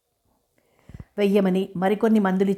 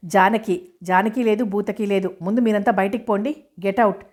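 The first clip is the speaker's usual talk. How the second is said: plain reading voice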